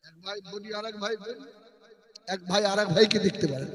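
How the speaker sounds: soft, close preaching voice; hushed sermon. Acted. Preacher telling a sad story to the crowd, hushed and tender. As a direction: draw the listeners in, hushed and tender.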